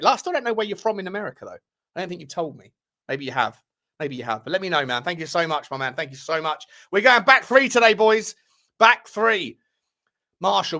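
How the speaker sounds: normal talking voice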